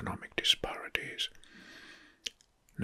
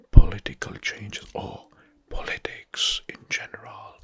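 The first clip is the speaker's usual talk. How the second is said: ASMR whisper. Acted. ASMR host whispering political facts to viewers. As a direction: breathe every word into the mic.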